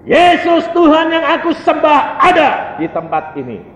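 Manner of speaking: high, forceful preacher's declaration; loud proclamation. Retold or imitated. Believer declaring Jesus present to the roommate, loud and fervent.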